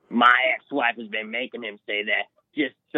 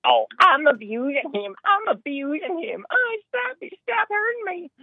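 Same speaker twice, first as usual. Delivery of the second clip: much higher, whining mockery of the son; mocking whiny son. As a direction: squeal it like a whiny teenager playing victim. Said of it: "and he says".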